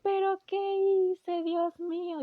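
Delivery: high, loud, slow anguished wail; a sobbing, slow "what have I done"; anguished cry. Retold or imitated. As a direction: cry out to God in despair. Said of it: "entra en un mar de lágrimas impresionante, así de".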